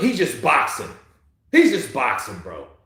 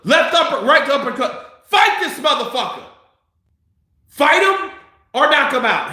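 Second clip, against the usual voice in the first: yelling at the fighter; 7 semitones higher than usual. Real, not acted. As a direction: bark it at the TV like a fan.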